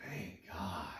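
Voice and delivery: breathy whisper of relief, low and soft. Retold or imitated. Young recruit whispering thanks to himself after the sergeant's words.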